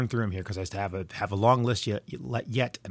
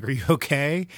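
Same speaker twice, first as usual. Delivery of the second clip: sudden worried pitch jump, louder; alarmed; 6 semitones higher, 5 dB louder than usual. Retold or imitated. Father to crying daughter, alarmed and louder. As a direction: blurt it out, scared for her.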